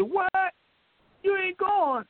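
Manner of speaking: high, incredulous what; a friend who can't believe it; shocked disbelief. Retold or imitated. Rodney shocked he's not going.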